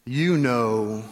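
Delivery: low, drawn-out parrot hint; parrot's slow, pointed insinuation; knowing parrot. Acted. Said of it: "parrot looks down on him and just says".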